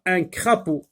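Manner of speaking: ordinary talk, nothing marked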